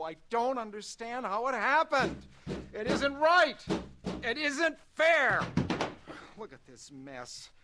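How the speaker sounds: anguished shouts, voice breaking; crying with rage. Acted. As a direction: rage at God through tears. Crash in the dark, raging at God.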